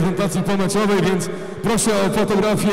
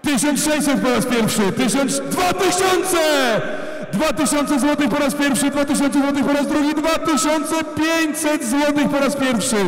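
high drawn-out auction calls building; auctioneer; 6 semitones higher, slower than usual. Real, not acted. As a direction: stretch each call for suspense. Auction climax.

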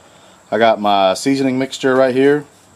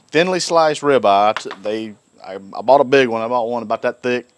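ordinary talk, nothing marked

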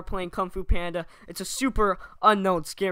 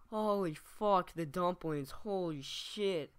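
shaken aftermath; 8 dB quieter, slower than usual. Pretend. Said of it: "fake reactions".

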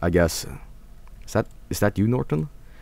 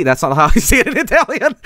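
higher-pitched, breaking into laughter; laughing; 11 semitones higher, faster than usual. Real, not acted. Streamer mocking their own Italian, laughing as they speak. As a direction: laugh through the words at your own attempt.

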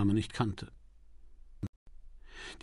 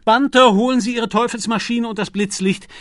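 eager orders; 10 semitones higher, 8 dB louder than usual. A narrator's reading.

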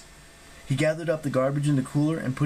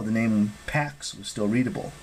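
usual speaking voice

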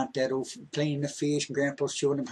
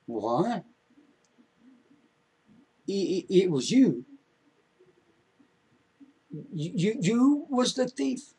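much higher, slow stammering shock; stunned disbelief; 7 semitones higher than usual. Acted. Grandson hears shocking confession.

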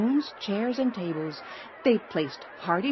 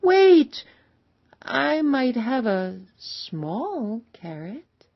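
high, loud "wait", then slow and halting; timid child; 6 semitones higher, 8 dB louder, much slower than usual. A narrator's reading. Child hesitantly offering a carrot to the travelers, timid child voice. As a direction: voice a small child piping up, drawn out. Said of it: "A child timidly raised her hand".

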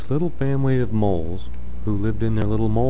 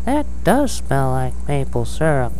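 lightly raised mama mole voice; a touch higher for the mama mole; 7 semitones higher than usual. Acted. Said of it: "said Mama Mole".

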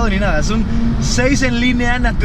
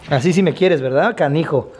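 tender to dog; 7 semitones lower than usual. Real, not acted. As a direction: coo at the dog fondly.

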